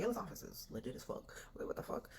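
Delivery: softly retold disbelief; hushed amazement. Tenant marvelling to herself at a stranger's office, hushed amazement. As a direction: drop to a hushed, stunned aside.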